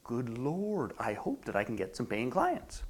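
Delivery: low, soft worried prayer; hushed, sinking inner worry; inside voice. Acted. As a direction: privately plead under your breath. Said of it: "And the inside voice says".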